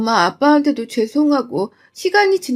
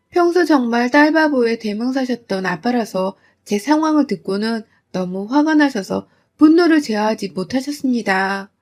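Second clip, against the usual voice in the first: ordinary delivery, nothing marked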